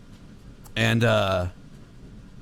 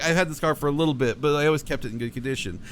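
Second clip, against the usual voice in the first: ordinary talk, nothing marked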